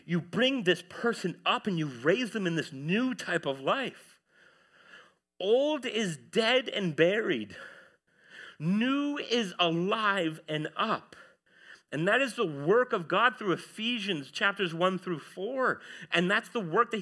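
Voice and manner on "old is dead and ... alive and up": slow, each word weighted; slow solemn preaching proclamation; slow emphatic declaration. Acted. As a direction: lay the words down one by one.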